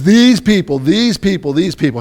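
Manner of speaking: loud, high repeated complaint, easing off; mock disdain for the crowd; acted exasperation. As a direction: accuse the others over and over. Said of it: "And so he says".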